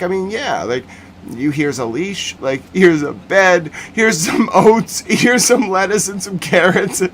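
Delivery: high, slowed voice giggling through it; a helpless staffer, barely holding back laughter; laughing impression. Staffer handing over goat supplies.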